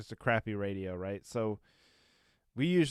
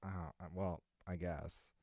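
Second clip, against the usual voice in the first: sheepish mumble; 6 semitones lower, 13 dB quieter than usual. Retold or imitated. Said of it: "It's like".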